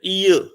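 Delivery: "ईय" as ee gliding into schwa, R silent; diphthong. Shown as a demonstration. Teacher explains the silent R, diphthong "ईय". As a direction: glide through "ईय" without any R. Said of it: "ये हमारे diphthong होती है".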